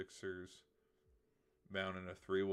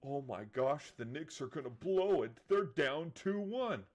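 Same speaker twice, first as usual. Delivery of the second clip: hammed-up freakout, rising to a squeak; mock panic; 6 semitones higher, 8 dB louder, slower than usual. Mocking fans who panicked over the Knicks, higher and louder. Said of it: "when the sky is falling and we're all panicking".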